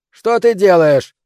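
high, loud, drawn-out yell; alarmed yell. A narrator's reading. Old man stopping girl at cabin door, yelling. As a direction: yell to stop someone in their tracks. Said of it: "закричал Финч".

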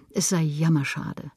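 plain reading voice

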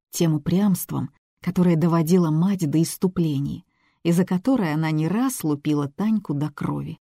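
usual speaking voice

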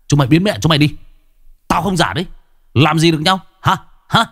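rising defiant taunt; defiant shout. Acted. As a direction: challenge them to do anything. Kiên pushes them away.

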